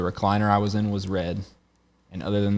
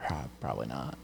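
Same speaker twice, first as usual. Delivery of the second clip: a much lower, much softer offhand admission; sheepish mumble; 8 semitones lower, 11 dB quieter than usual. Retold or imitated. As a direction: shrug it off under his breath. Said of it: "I was like".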